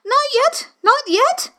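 high and hurried, cutting in; hasty high rabbit voice. A narrator's reading. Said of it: "the rabbit hastily interrupted".

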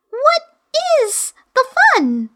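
put-on high, curious girl's voice; little girl Alice. Alice questioning the Griffin in a high girl's voice. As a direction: ask it as a puzzled young girl.

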